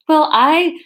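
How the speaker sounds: emphatic put-on Scottish accent; Scottish accent retake. Shown as a demonstration. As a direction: anchor the Scottish accent on AYE. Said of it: "way to keep the accent going".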